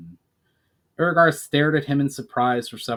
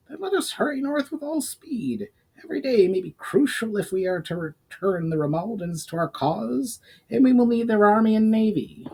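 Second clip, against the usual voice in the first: higher goblin, urgent and eager; 10 semitones higher than usual. Acted. Goblin urging the elf to hurry north, higher eager voice. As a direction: push it higher and keen to move.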